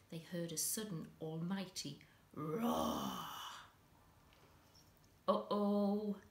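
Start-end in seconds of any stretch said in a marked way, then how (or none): 5.3-6.2 louder, startled uh-oh; the moment you know trouble's coming; alarmed uh-oh. Acted. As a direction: boys hearing a roar from the kitchen, startled and louder. Boys hear a roar.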